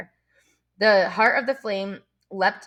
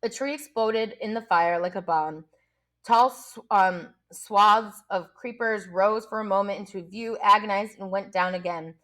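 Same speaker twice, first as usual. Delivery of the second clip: ordinary delivery, nothing marked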